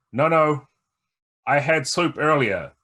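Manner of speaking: embarrassed loud no thanks; flustered refusal. Acted. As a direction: brush off the soup offer, flustered. Player declines soup, louder.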